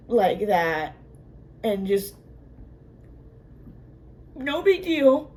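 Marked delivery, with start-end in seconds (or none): 4.4-5.3 high-pitched disbelief, rising at the end; incredulous. Real, not acted. Viewer protesting how casually she shot him, high incredulity. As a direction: let the voice climb high in protest. Outraged disbelief at character's death.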